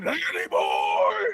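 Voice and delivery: screamed high and loud; axe-man scream. Acted. Axe-wielding man sprinting through the mall, screaming high and loud. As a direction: yell it as you sprint past.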